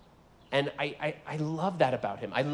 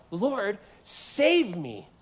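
slow, drawn-out cry for rescue; desperate plea; 5 semitones higher, much slower than usual. Acted. Preacher acts out Peter begging the Lord, slow and desperate. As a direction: stretch out each word like a desperate plea. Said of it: "the text says he cried out".

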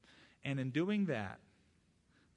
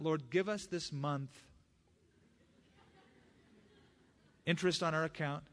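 mock greedy prayer. Shown as a demonstration.

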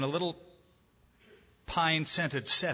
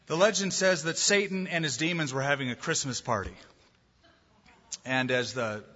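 ordinary delivery

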